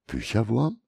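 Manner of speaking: erstaunte Frage, deutlich höher; ungläubig nachfragen, Stimme steigt hoch; erstaunt. A narrator's reading. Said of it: "fragt Navila indes erstaunt". (Navila fragt erstaunt nach dem Bücherwurm, hoch und ungläubig)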